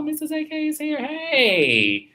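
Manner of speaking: very high, delighted squeal of welcome; excited greeting. Real, not acted. Host spotting Missus A K arriving, excited. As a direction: sing out a high, stretched hello.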